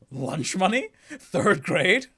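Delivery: high, loud incredulous questions; baffled. Acted. Edgeworth baffled by the third grade story, loud and incredulous. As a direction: fire off confused questions.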